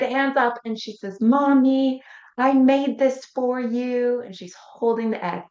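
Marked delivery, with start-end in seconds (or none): 1.2-4.2 put-on high, louder, slow little-girl voice; daughter offering gift. Little girl holding egg up to mom, high and eager. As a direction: lift it up bright like a child holding out a gift.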